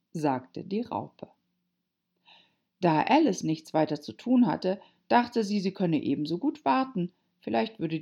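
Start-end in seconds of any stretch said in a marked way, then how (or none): none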